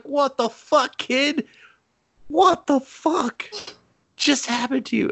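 very high, hammed-up horror; shocked disbelief. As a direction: squeal your comic disbelief, drawing out the words. Teller's shock at boy being eaten.

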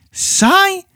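a high incredulous echo of the word; surprised. A narrator's reading. Mother to bored daughter, incredulous echo.

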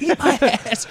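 high comeback cracked by laughter; laughing retort. Retold or imitated. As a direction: toss the insult back through a laugh. Laughing through retold insult.

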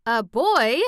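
startled outburst of a whole crowd; shocked echo. A narrator's reading. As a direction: echo it back in disbelief. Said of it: "echoed every voice".

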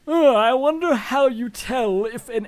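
mock an airheaded aristocrat; snooty rich customer. The GM voicing a rich shopper at the pet store door, high and snobbish. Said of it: "voice go".

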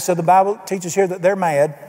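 normal talking voice

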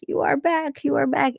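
put-on high, cute sing-song girl voice; lightly put-on Japanese-accented girl; imitating Susie. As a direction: chirp it like a manic kawaii character. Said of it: "with a very thick Japanese accent, she goes".

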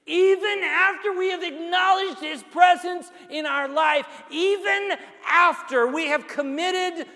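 full-force sermon climax; fiery preaching. Acted. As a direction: preach it to the rafters.

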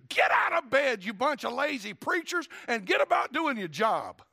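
loud, high rousting yell; acted-out angry wake-up call; scolding shout. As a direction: bellow at sleepers to get up and work. Acts businessman scolding lazy preachers.

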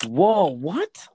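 a high, startled whoa, what; genuinely thrown by the news; surprised. Real, not acted. Hearing the House backed a second shooter, real surprise. Reacting to shocking fact.

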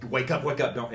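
urgent wake-up call, only lightly yelled; retold yelling. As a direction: imitate someone yelling to rouse him, half-strength. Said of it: "she'd start yelling".

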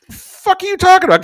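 high-pitched "what are you on about"; indignant disbelief. Retold or imitated. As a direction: shoot up in outraged disbelief. Indignant quote at friend.

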